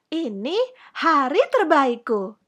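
put-on high, emphatic happy child; delighted, smiling. A narrator's reading. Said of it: "sambil tersenyum lebar".

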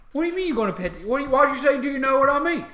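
loud, high orc challenge; bickering orc. Acted. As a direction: demand an answer as a baffled orc. Orc guards squabble over petting dog.

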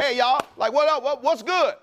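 high, loose street greeting; slang greeting. Retold or imitated. Jesus to the women, high casual slang. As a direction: greet them like old friends. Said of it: "He just came and he just said".

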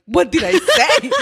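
high, slow laughing question; laughing disbelief. Retold or imitated. Reacting to friend reading her old letter, laughing and high. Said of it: "I'm like".

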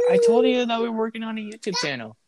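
high-pitched, exasperated protest; incredulous high-pitched complaint; exasperated reminder. Real, not acted. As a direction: remind a forgetful friend, voice jumping up high. Co-host forgot what he was told.

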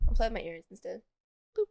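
usual speaking voice

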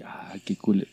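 a soft mock-pleased whisper; a hushed mock-delighted whisper. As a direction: whisper it to classmates. Class reacting secretly.

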